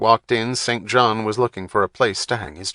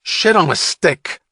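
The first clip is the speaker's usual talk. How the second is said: slow, higher, louder curse; snapped curse; 8 semitones higher, 6 dB louder than usual. A narrator's reading. Wapner snapping at Saint John, irritated curse. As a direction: snap it out. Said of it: "Wapner snapped".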